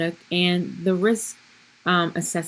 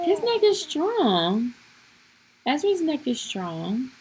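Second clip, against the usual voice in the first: put-on high squealy baby talk, slow; squealing baby talk aunt; 8 semitones higher, slower than usual. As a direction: coo at the baby like an auntie. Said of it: "my auntie voice".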